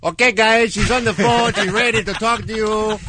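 high, casual mock producer chatter; [name]'s regular voice. Cohost as producer briefing the hosts, high everyday voice. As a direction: pitch it well up, loose and informal. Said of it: "his regular [name] voice".

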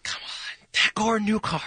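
whisper. Acted. Host whispers the clue.

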